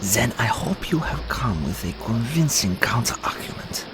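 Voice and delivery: voiceless, hushed counsel; whispered aside. Acted. Ally asks Lise for a counter-argument, whispered aside. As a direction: whisper it privately.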